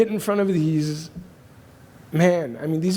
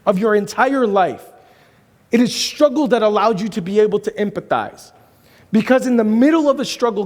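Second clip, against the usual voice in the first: loud, high preacher's build; preaching; 8 semitones higher, 5 dB louder than usual. Acted. Pastor preaching to the crowd, loud and high. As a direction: drive the point home to the congregation.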